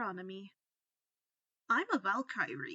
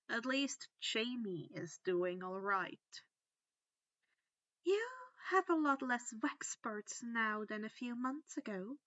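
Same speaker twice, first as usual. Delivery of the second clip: ordinary delivery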